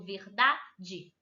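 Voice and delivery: "verdade" slowly, syllable by syllable, DA as the strong one; teacher slowly breaking up "verdade" to show the stressed DA. Shown as a demonstration. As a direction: sound out "verdade" slowly, leaning on its middle syllable DA. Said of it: "Qual é o acento tônico?".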